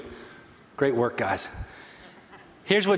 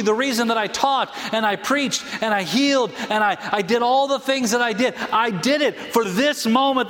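raised pitch, building list with insistent drive; impassioned preaching as Jesus; 5 semitones higher than usual. Acted. Preacher voicing Jesus explaining his purpose, impassioned and insistent. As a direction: preach it with rising conviction.

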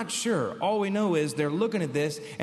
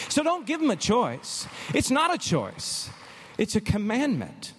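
preaching climax; 7 semitones higher than usual. Acted. Retold advice climax, preaching.

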